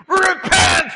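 high, full-volume prophet's cry; hammed-up preacher's shout; shouted warning. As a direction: bellow it like a prophet on the shore. Jonah calls to Nineveh.

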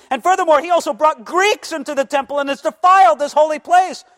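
high, loud accusing yell; mob screaming. Retold or imitated. Mob crying out to the men of Israel, a loud high yell. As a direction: rouse the crowd against him, loud and high. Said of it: "start screaming".

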